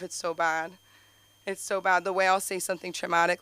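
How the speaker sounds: ordinary talk, nothing marked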